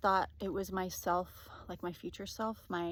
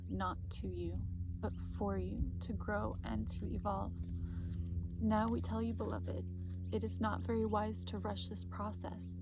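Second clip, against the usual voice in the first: channeled message reading. Acted. Reading a spirit guide's message to viewers, soothing. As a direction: give it calmly, like a gentle oracle.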